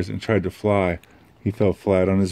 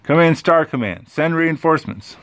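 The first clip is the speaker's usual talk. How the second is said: higher, louder urgent command; panicked radio call; 8 semitones higher, 5 dB louder than usual. Acted. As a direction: bark it into the wrist radio. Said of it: "started yelling".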